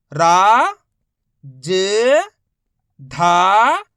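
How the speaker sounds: loud, held रा, ज, धा, each separate; long rising letter drill on रा, ज, धा; sounding out letters. Shown as a demonstration. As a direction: call out रा, ज, धा with pauses between. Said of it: "एक ही अक्षर का उच्चारण करके".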